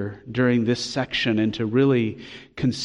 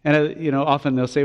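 ordinary talk, nothing marked

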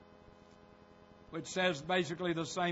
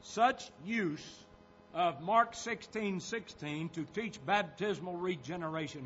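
usual speaking voice

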